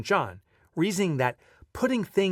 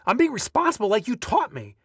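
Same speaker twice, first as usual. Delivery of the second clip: higher, defensive retort; raised-pitch snappy comeback; snapping back; 8 semitones higher, 4 dB louder than usual. A narrator's reading. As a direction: protest indignantly to parents. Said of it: "I remember snapping back".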